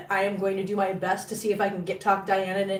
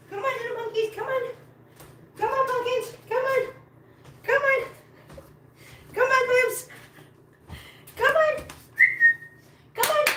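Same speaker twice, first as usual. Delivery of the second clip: calling cats, building; 13 semitones higher than usual. Real, not acted. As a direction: pipe it up high, build with each call. Calling cats with rising urgency.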